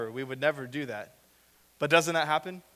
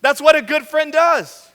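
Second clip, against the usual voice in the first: loud, high, slow mock praise; exaggerated ironic approval; sarcastic; 12 semitones higher, 13 dB louder, slower than usual. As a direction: drip with sarcasm. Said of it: "sarcastic peak".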